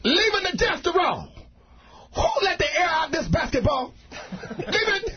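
put-on father yelling from the porch at his children; Southern parent yelling. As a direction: holler at the kids from the front porch. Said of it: "he's yelling at his young children".

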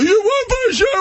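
put-on very high, fast drunken outburst; squealed, hammed-up heckle; mock drunk yelling. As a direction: yell like the belligerent drunk cutting in. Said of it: "the guy starts yelling".

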